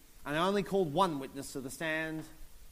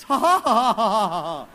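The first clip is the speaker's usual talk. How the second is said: fake mocking laugh; 4 semitones higher, 13 dB louder than usual.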